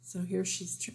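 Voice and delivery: ordinary delivery, nothing marked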